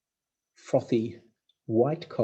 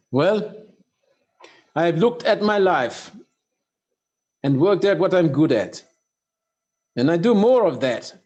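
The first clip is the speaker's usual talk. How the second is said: louder, higher imitation of a Russian accent; Sergei's Russian accent; 6 semitones higher, 8 dB louder than usual. Retelling Sergei's secret to the audience, in a Russian accent. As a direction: do your best Russian accent, raised up. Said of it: "he said it with a Russian accent, so I'll do my best".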